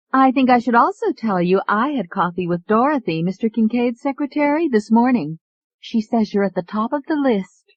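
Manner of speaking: high, eager secretary; lightly voiced young woman. A narrator's reading. Secretary shares gossip.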